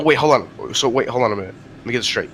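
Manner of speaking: ordinary delivery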